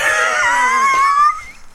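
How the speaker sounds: huge high screaming laugh; shrieking laugh. Real, not acted. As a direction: burst into a shrill howl of laughter. Wild laugh after joke.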